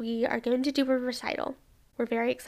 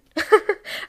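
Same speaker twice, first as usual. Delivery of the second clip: burst of laughter; 8 semitones higher, 6 dB louder than usual. Real, not acted. Laughs recalling sitting on phone.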